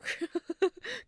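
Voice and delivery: high giggle. Real, not acted. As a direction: giggle at the innuendo. Laughing at innuendo.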